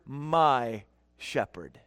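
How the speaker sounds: "my" drawn out very slow, low and soft; stress on MY. Shown as a demonstration. Pastor showing congregation how to say it, stress on "my". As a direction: sink low and linger on "my". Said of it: "emphasize the word".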